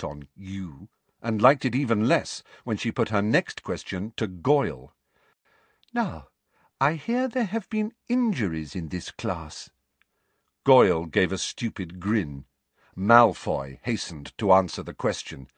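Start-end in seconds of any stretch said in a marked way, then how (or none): 5.9-9.7 high, prim and falsely sweet; a lightly voiced prim inspector probing; sweetly voiced Umbridge. A narrator's reading.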